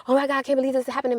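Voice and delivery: high, fast, panicked rush of words; reliving the moment she realised she'd been hacked, blurted high and fast; retold panic. Vlogger retelling her panic to viewers, high and fast. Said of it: "I was freaking out".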